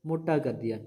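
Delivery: ordinary talk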